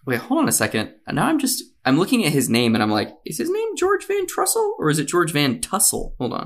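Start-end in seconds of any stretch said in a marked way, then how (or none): 3.2-6.1 high incredulous question. Real, not acted. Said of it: "I think I said it wrong".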